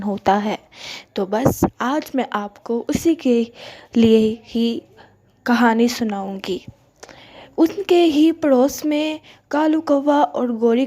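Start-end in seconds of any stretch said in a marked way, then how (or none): none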